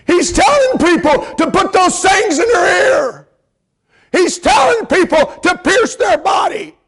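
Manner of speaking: shouted, high sermon climax; thundering revival preacher; full-force preaching. Acted. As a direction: preach it out hard, voice ringing.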